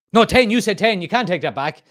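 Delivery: high, loud indignant retort; Rachel's protest. Retold or imitated. Rachel from Friends objecting to Joey's haggling, indignant. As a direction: protest loudly, easing off by the end. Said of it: "and she says".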